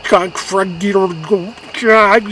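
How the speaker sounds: loud, high imitation of incoherent screaming; garbled yelling, acting out his daughter's outburst; screamed gibberish. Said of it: "screaming at the top of her lungs, just gibberish".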